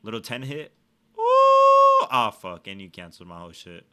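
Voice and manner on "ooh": loud high-pitched ooh; high excited squeal. Real, not acted. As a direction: squeal at the hit. Sudden hit in game.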